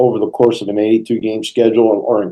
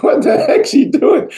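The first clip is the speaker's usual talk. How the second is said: incredulous teammates. Retold or imitated. Teammates laughing on the bench.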